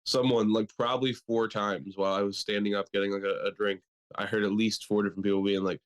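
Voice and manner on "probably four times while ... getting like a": ordinary delivery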